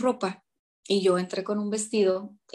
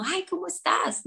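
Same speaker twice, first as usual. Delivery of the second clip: caring greeting; 10 semitones higher than usual. Retold or imitated. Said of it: "A veces que con uno".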